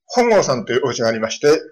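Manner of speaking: usual speaking voice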